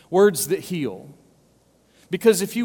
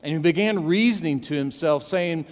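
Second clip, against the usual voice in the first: raised, brisk Bible reading; scripture reading; 4 semitones higher than usual. Acted. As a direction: read the verses with weight. Reads scripture aloud.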